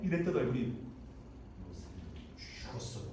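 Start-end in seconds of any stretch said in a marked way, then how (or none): 1.7-3.0 hushed, voiceless threat; menacing whisper. Acted. Scorned wife threatening Joseph, menacing whisper. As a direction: whisper it like a vow of revenge. 거절당한 여자가 원한을 품음.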